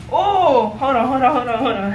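normal talking voice

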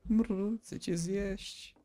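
slow, drawn-out purr into a hungry threat; mock purring zombie. Gamer voicing a zombie that purrs at him, slow and hungry. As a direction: purr it slowly like a lovesick monster.

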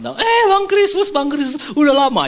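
loud, high-pitched hail; hammed-up welcoming shout; imitated excited greeting. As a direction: call out to a long-awaited guest. Hosts greet the late guest.

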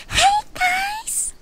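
very high, bubbly cutesy greeting; high-pitched cutesy VTuber voice. Shown as a demonstration. Vtuber shows chat the high-pitched voice. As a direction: squeal it like a cutesy streamer. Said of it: "I can do the high-pitched voice too".

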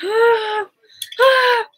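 high, loud excited "ah" squeals. Real, not acted. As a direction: let out short, high squeals of nervous excitement. Overwhelmed with excitement before premiere.

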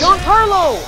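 loud high call of a name; yelling across the room. Real, not acted. Calling teammate from afar.

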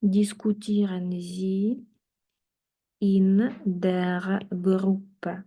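slow, careful dictation-style German, word by word; slow model phrase. Shown as a demonstration. Teacher to class, modelling German slowly and carefully. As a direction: spell it out slowly for the learners. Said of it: "teacher models slowly for class".

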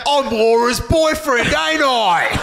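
loud hammed-up boyfriend voice; impression of partner. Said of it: "Can you do an impression of him?".

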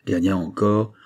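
usual speaking voice

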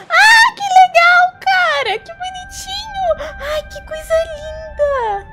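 high squealing delight; cute anime streamer. Real, not acted. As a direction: squeal with joy at cute things. Squealing at the game.

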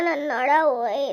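slow wailing cry of pain. Acted. Woman who fell from the tree, wailing in pain. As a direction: wail as if the back just broke.

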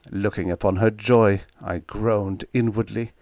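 ordinary delivery

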